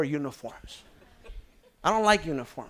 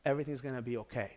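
soft inner voice; 4 semitones lower, 8 dB quieter, faster than usual. Retold or imitated. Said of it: "all I heard was".

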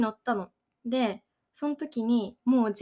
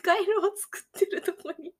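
laughing; 6 semitones higher than usual. Real, not acted. Laughing through painful ride story.

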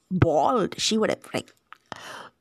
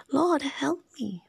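slow whispered "Lord, help me"; whispered plea; 6 semitones higher, slower than usual. Retold or imitated. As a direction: breathe the words as a humble plea. Woman's plea quoted softly.

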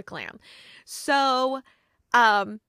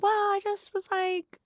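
lightly voiced sheepish friend; 10 semitones higher than usual. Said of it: "She's like".

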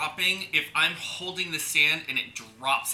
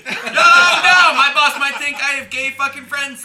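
loud, higher, drawn-out protest through laughter; laughing protest; 5 semitones higher, 8 dB louder, slower than usual. Real, not acted. Man to teasing friend about his boss, loud laughing protest. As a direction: push back loudly while laughing.

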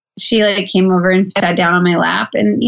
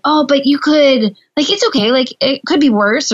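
fixing reassurance; 5 semitones higher than usual. Retold or imitated. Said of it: "trying to be like".

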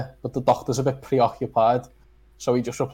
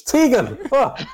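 much higher, louder scream with a laugh in it; 12 semitones higher, 4 dB louder, slower than usual. Acted. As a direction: scream the name down the phone. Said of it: "he just screams".

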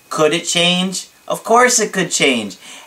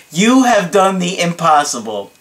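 higher, emphatic hype voice; dramatic client imitation; 3 semitones higher than usual. Client hailing his coach's feat, dramatic and emphatic. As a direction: play up the awed admiration word by word. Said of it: "I wish I could do it in his voice".

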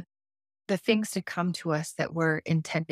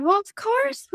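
sugary, much higher, syrupy sweetness; overly nice and sweet; 12 semitones higher, 4 dB louder than usual. Retold or imitated. Rental car clerk greeting a late traveller, overly nice and sweet. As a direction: lay the sweetness on thick, pitched way up. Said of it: "overly nice and sweet".

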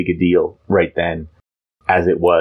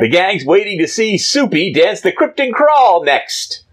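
put-on hip teen voice; 10 semitones higher than usual. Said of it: "Jimmy Olsen saying".

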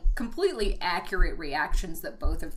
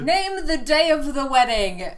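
loud, higher hammed-up command; mock eager prince; 5 semitones higher, 7 dB louder than usual. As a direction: declare it like a proud dim prince. Voicing Derek in recap.

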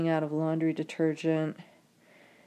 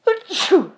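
big sudden sneeze, high and loud; loud real sneeze; 15 dB louder than usual. Real, not acted. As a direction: let out a full explosive sneeze. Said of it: "I'm gonna sneeze".

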